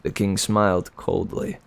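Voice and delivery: ordinary delivery, nothing marked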